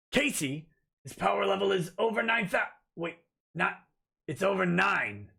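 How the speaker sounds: lightly voiced Vegeta, mock scouter reading; a hint of Vegeta sneering at a power level. Host reading a chat joke in a light Vegeta voice. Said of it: "Now in Vegeta's voice".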